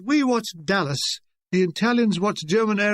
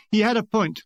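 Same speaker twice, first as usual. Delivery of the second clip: ordinary delivery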